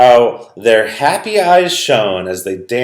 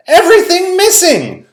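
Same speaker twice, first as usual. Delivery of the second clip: much higher, louder exclamation; surprised girl; 7 dB louder than usual. Acted. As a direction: gasp it in amazement. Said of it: "said Lorraine with surprise".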